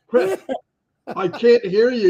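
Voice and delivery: high mock call of Chris; imitating Chris. Host mimicking Chris on a bad connection. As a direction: pitch up and call out the name. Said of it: "and he goes".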